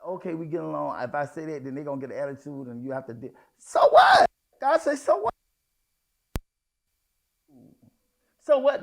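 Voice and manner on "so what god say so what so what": loud, high preaching cry of so what; shouted so what. Real, not acted. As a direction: fling it out at full voice, dismissive. Preacher shouting God's answer.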